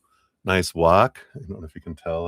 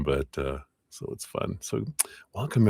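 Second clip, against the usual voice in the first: normal talking voice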